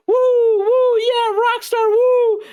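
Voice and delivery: high, loud gushing whoop; excited fan cheer. Retold or imitated. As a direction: cheer like a thrilled fanboy. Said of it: "You're like".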